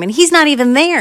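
ordinary delivery, nothing marked

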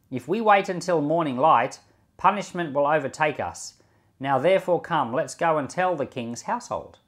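ordinary delivery

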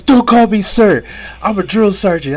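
mock drill sergeant shout; drill sergeant yell. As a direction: bark it like a drill sergeant correcting a recruit. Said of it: "He goes".

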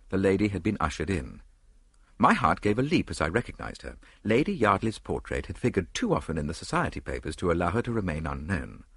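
ordinary delivery, nothing marked

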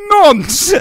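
sudden high, loud shout; sorting hat's outburst. Acted. Co-host plays the hat judging Paul, loud high shout. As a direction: yell the verdict as the hat. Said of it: "that just goes".

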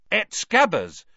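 loud, high indignant outburst; heated, accusing interruption; angry interjection. A narrator's reading. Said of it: "Ron interjected angrily".

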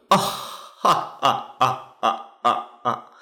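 mock snarky ah-ha-ha laugh; imitating a smug dismissive chuckle. The host mocking a fan's question with a snarky laugh. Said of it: "a snarky laugh".

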